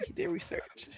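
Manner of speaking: hushed creepy whisper. Acted. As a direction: drop to a whisper.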